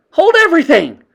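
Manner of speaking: very high, loud shrieked Wait!. Acted. A witch in the back row halting everyone, shrieking. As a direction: stop them all with a shriek. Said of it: "Her shrieking voice echoed".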